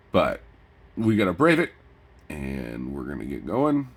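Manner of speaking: ordinary delivery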